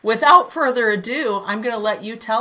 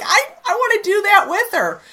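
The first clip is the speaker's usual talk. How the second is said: excited thought; 13 semitones higher, 6 dB louder than usual. Retold or imitated. Excited quote of own thought.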